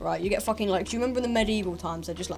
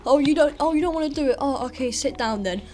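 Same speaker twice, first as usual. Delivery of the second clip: mock sympathetic teacher; 6 semitones higher, 5 dB louder than usual. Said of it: "they're just like".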